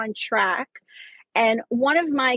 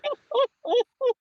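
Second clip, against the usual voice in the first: high burst of laughter; 13 semitones higher than usual. Real, not acted. Reacts to the dark ending.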